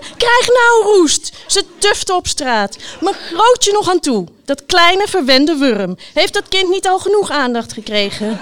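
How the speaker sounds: high, loud indignant rant; shouted outburst. A narrator's reading. Knight rants about the winning child, shouted outburst. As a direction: rail loudly, drawing out the curses. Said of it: "riep de ridster".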